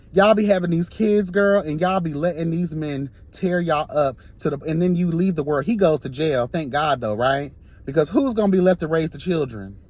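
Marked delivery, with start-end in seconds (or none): none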